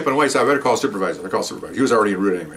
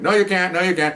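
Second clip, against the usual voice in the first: much higher, mock indignant protest; imitating protesting woman; 8 semitones higher than usual. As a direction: squeal her protest up high. Quarrel with officer.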